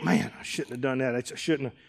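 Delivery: regretful mumble to yourself; guilty muttering. Retold or imitated. Preacher voices a guilt-ridden person, soft and muttered.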